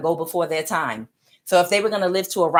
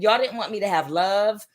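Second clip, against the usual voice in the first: ordinary talk, nothing marked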